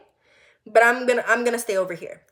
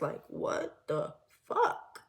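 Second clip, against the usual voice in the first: shocked whisper; 6 semitones lower, 9 dB quieter, much slower than usual. Real, not acted. Shocked inner reaction at the party.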